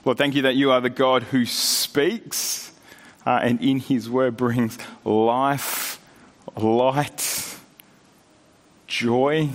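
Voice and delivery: low, unhurried prayer voice; pastor's prayer. Acted. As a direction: pray over the congregation, reverent and steady. Opening prayer.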